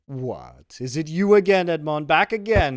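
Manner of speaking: high, booming surprised greeting; broad Marseillaise greeting. A narrator's reading. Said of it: "with a broad Marseillaise accent and".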